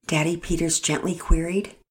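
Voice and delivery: plain reading voice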